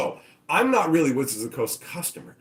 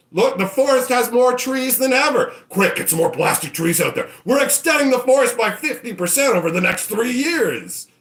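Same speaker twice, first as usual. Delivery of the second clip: much higher and louder salesy boast; hammed-up boasting; 8 semitones higher, 5 dB louder than usual. Company bragging to shareholders about plastic trees, hammed-up. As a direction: sell it like a giddy pitchman.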